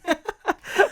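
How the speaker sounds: high, loud burst of laughter. Real, not acted. Embarrassed after failed accent request.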